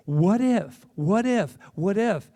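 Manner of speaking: loud, building what ifs; rising challenge. Real, not acted. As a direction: hammer each what if.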